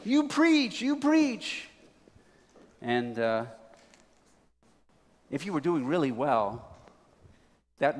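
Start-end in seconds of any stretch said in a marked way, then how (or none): 0.0-1.7 high-pitched deacon cheering the preacher on; high congregational call; deacon's shout-out. Acted. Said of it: "shout out maybe".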